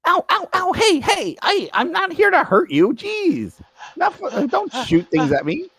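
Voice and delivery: high-pitched, hurt yelping; pained protest. Acted. Player's windling hit by an arrow protests, high yelps. Shot character protests to the shooter.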